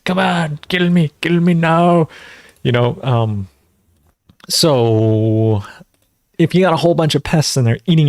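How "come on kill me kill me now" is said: imitating a desperate movie fighter, pitched higher; half-hearted yelled dare to the pest; playful higher-pitched mock plea to be killed. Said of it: "The plant is just yelling at the pest".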